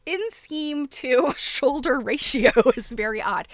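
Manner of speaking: words broken by giggles; laughing through it. Real, not acted. Host laughing to viewers about the doll's odd fit. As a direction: giggle your way through the line.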